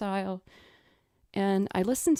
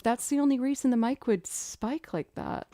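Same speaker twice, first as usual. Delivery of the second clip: ordinary talk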